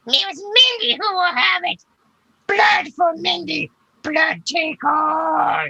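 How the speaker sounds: very high, loud falsetto creature; high-pitched echoing she-creature call; female voice. Acted. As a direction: put on a piercing, slow female monster voice. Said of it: "You hear a female voice say".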